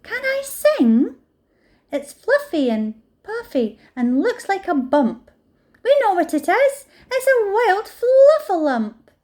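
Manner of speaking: high, loud, eager little meerkat voices; chattering meerkats sure they know the answer; excited meerkats. A narrator's reading. Meerkats poke the thing and name it, high and eager.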